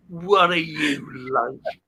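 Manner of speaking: slow, scornful put-down from the daughter; mock the dad's mix-up with slow scorn; contemptuous daughter. Daughter scolding her dad over a name mix-up, slow and scornful. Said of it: "looked at me with such contempt".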